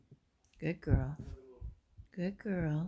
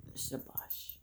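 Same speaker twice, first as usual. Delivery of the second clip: hushed whisper; 15 dB quieter than usual. Real, not acted. Owner whispering to viewers about the boss dog. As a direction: breathe it out under the breath.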